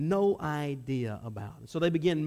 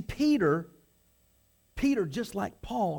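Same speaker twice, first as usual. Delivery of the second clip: ordinary talk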